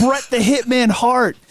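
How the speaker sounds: high, excited fanboy outburst; starstruck fan. Retold or imitated. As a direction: imitate a guard geeking out over a find. Guard thrilled by the toys, retold.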